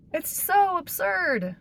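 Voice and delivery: high, slow, disbelieving; incredulous. Real, not acted. Disbelief at the investigation.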